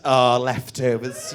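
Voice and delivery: slow, drawn-out pitying "oh"; mock-pitying coo, stretched slowly; mock sympathy. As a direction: imitate a sympathetic audience member, drawing it out. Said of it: "She went".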